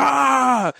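high drawn-out mock scream. Man slashed across the chest, high mock scream. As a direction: imitate a high cry of pain.

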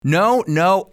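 ordinary talk, nothing marked

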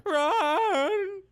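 high, loud drawn-out cry; panicked yell. Acted. Wizard fleeing the bandits, panicked yell. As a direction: holler it while fleeing.

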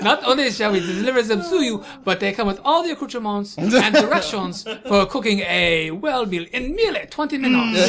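high, grand ad-pitch voice, laughing; french chef. Acted. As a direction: sell it like an overdone French chef. Ad parody in French voice.

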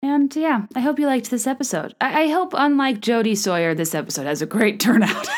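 ordinary delivery

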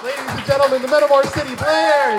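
big, high, loud stage announcement; a host presenting the players to the audience; announcer introduction. Real, not acted. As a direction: call it out to the whole hall. Introducing the troupe after the show.

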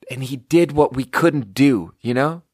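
ordinary talk, nothing marked

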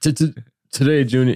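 stammering mockery; mock stutter. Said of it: "you know".

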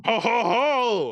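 hammed-up French host's belly laugh; French accent. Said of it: "I hate this accent so much".

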